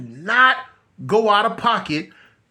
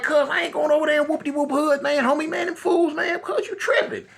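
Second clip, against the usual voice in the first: much higher, incredulous protest; you must be crazy, cuz; retold refusal; 7 semitones higher than usual. Refusing his homie's plan, high incredulous protest.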